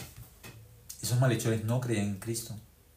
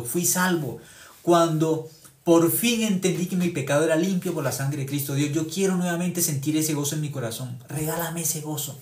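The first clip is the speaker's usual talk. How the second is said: David's prayer; 5 semitones higher, 5 dB louder than usual. Retold or imitated. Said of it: "Él le pidió a Dios".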